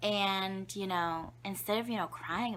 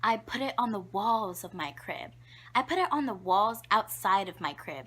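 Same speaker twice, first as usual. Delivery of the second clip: normal talking voice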